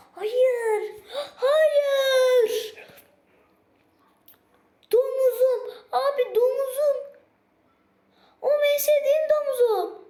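overdone fake sobbing over a lost pet; hammed-up crying. Mourning his dead pet pig to friends, hammed-up wailing.

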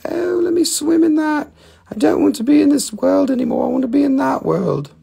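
swooning falsetto, lost in the beauty; breathless, starry-eyed daydream; dreamy high voice. Retold or imitated. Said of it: "and be like".

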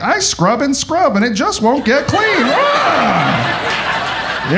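high-pitched, hammed-up infomercial despair; frustrated infomercial actor. Comic mocks infomercials over washing, hammed-up frustration. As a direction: wail it like a helpless infomercial customer. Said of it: "not like I'm some infomercial where I'm like".

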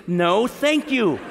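slow, exaggeratedly polite refusal; very courteous. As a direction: decline with drawn-out, showy courtesy. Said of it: "I also was very courteous".

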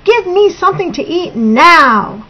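loud, high storybook shout; shouted demand. A narrator's reading. Starving Bird orders Rabbit to feed him, shouting. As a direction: demand it, building to a big last word. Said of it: "shouted Bird".

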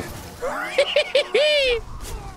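squealing high-pitched cackle; high delighted laugh; high falsetto laugh. Real, not acted. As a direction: let out a shrieking giggle. Laughs at the enemy.

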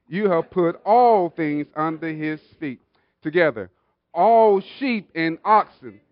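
loud, measured, proclaiming read; responsive scripture reading. Real, not acted. Pastor leading the congregation in a psalm, proclaiming scripture. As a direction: lead the congregation through it, stretching key words.

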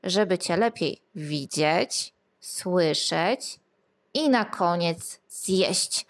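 plain reading voice

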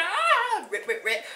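high excited ah, then a lightly voiced rip rip rip; mock-eager unwrapping, a touch playful; a squeal, then playful tearing sounds. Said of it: "I was just like".

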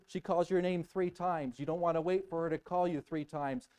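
ordinary talk, nothing marked

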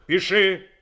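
stern command. Acted. Officer forcing captive to sign.

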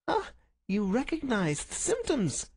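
high, slow, savoring question; gloating interest. Acted. Visitor gloats over the symptoms, slow and smug. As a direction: draw the question out smugly.

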